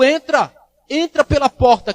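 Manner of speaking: ringing pulpit command; shepherd's call. Acted. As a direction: call the flock in, loud and high. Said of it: "É a voz do meu pastor, dizendo".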